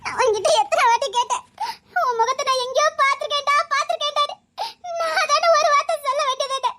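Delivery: laughing through tears; half laughing, half sobbing, with tender reproach; crying. Acted. To the long-lost boy she finds again, crying and laughing. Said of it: "அழாத".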